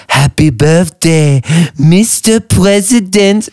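slow, breathy-sultry birthday song, rising; Marilyn Monroe impression. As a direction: croon it like Marilyn. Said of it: "Marilyn Monroe".

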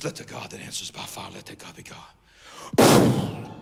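very low, barely voiced prayer; hushed prayer. Prophet calls on God to answer by fire, hushed. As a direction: say it under your breath, very softly.

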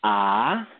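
"ah" rising like a question; rising Mandarin tone on "ah"; second tone. Shown as a demonstration. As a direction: lift "ah" upward as if asking. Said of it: "the second tone".

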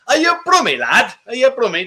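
put-on terrible Brummie accent. Teasing a viewer who mentioned Dudley, bad Brummie accent. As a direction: put on a hammy, awful Brummie twang, loud and high. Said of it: "That's the worst Brummie accent in the world".

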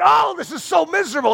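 high-pitched wail of misery; hammed-up miserable moan; mock crying out. Rest of the world during the wall sit, mock wailing. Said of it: "screaming and crying out".

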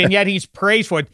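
ordinary delivery

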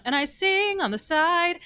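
high, drawn-out quoted movie line; slow it down and pitch it up, imitating. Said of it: "'cause I think [name]".

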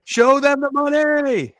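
big shouted catchphrase. Shown as a demonstration. Co-host asks for a redo, so he shouts it. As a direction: shout it high and loud, slowly. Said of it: "Do it, do it again".

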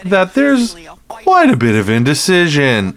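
high, loud, stagey newscaster delivery; field reporter voice. Retold or imitated. Mocking local news reporters on air, in a high, loud newscaster voice. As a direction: play up the old-school TV correspondent. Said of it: "why are you talking that way?".

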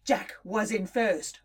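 ordinary delivery

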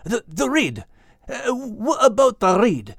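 high, stammering urgent question; Max, desperate. A narrator's reading. Max begs the vanishing image to explain, high desperate voice. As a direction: grasp at the word in a high, faltering voice. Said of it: "Max desperately replied".

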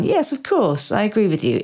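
high-starting, mock agreeable voice; outward agreement. Client outwardly going along with the therapist, mock agreeable. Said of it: "on the outside".

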